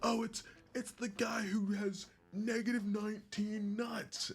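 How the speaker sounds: high-pitched mock onlookers; mocking improv crowd. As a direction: say it way up high, imitating the room. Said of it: "they're like".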